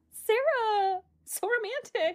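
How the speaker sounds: high, gushing teasing; teasing delight. Real, not acted. Teasing co-host about her soulmate note, high and gushing. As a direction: swoon over your friend's note.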